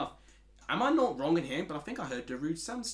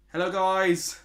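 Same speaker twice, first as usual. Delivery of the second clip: loud, higher-pitched imitation of another man's greeting; Matt; 5 semitones higher, 10 dB louder, slower than usual. Shown as a demonstration. Streamer doing a requested impression of an absent-voiced friend, loud mimicry. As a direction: call it out loud and high as a quick impression. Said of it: "Do an impression of Matt".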